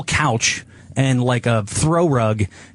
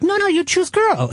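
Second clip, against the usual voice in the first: high mock insistent owner.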